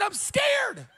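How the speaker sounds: high shouted whimper; hammed-up fear; mock scared lady. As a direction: squeal it like the frightened lady. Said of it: "She said".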